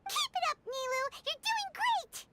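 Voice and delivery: high, bright cheer from the crowd. Acted.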